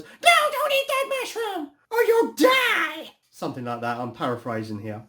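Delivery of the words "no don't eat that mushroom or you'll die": very high, loud squealed bellow; Toad. Retold or imitated. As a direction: shriek a frantic cartoon warning, dragging out the last word. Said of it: "like, bellows at Mario".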